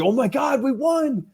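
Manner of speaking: high-pitched imitated cheer; mock elation. Podcaster voicing La La Land winners celebrating, high mock-excited squeal. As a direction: squeal it as a pretend overjoyed winner.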